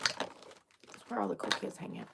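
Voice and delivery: very soft voiceless whisper; hushed, breath-only repeat. Pretend. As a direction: whisper it like a secret. Same line repeated in a whisper.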